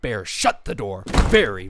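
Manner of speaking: usual speaking voice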